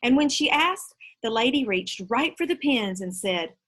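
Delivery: ordinary delivery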